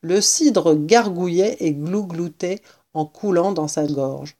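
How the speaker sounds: usual speaking voice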